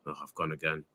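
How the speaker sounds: normal talking voice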